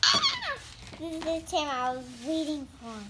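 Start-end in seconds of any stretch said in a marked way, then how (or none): 0.0-0.6 short, much higher squeal of delight; excited squeal. Real, not acted. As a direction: let out a sudden happy shriek. Kid spots the wanted doll.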